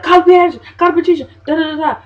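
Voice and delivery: very high, loud panicked order; frantic yelling. Retold or imitated. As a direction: bark it out in a panic. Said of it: "there's like".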